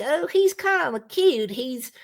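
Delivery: high, swooning mock admiration; mock gushing admirer. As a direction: gush like a smitten fan charmed by him. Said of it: "and you think".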